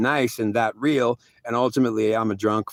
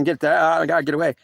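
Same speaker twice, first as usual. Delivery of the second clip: retold panic; 6 semitones higher, 3 dB louder than usual. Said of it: "I was having an anxiety attack".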